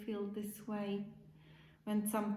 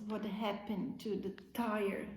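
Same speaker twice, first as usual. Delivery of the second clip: usual speaking voice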